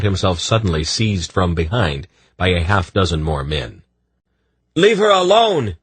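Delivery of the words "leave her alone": much higher, loud furious yell; angry shout. A narrator's reading. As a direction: yell at the guard. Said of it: "he shouted at the guard".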